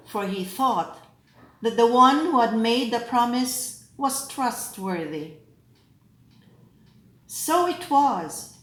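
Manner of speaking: measured, solemn proclamation with deliberate pauses; lector reading scripture. Acted. Lector proclaiming the readings to the assembly, solemn.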